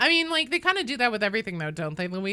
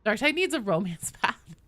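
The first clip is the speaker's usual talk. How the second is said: ordinary delivery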